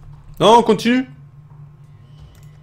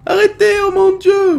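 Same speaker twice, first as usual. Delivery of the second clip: very high, hammed-up plea; play-acted high-pitched begging; mock pleading. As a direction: squeal it like a panicked victim. Begging the game's attackers to stop.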